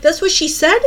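high, loud retold disbelief; incredulous question. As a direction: pitch it up in outraged disbelief. Retold disbelief at brother's report.

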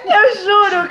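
high laughing, mid-sentence. Real, not acted. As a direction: laugh while you swear it. (rindo alto enquanto jura)

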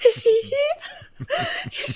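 high, drawn-out squeal through nervous laughter; mock-panicked, giggly high squeak; starstruck fluster. Filmmaker recalling meeting a pop idol, starstruck fluster. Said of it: "like, sweating".

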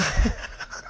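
short unvoiced chuckle; breathy laugh. Real, not acted. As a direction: let out a quick airy laugh. Laughing at son's correction.

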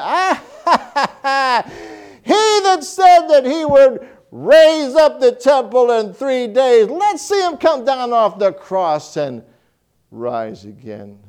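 high, loud jeering laugh and taunt; mocking scoffers. Preacher voicing the scoffers at the cross, high jeering taunt. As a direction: jeer at him like a mocking crowd. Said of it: "the mockers and the scoffers were going back".

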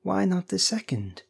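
high, insistent question; feverish. A narrator's reading. Old woman pushing for another wish, feverish. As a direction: press the point feverishly, pitch lifted. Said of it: "said the old woman feverishly".

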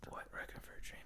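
very soft whispered question. Pretend. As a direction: whisper back, barely audible. Answers the whisper in whisper.

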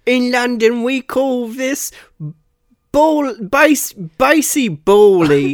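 high, slow mock English accent; London accent. Playing along as a Londoner naming baseball, in a mock British accent. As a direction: stretch it out in a silly British accent. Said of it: "Now he's from London, England".

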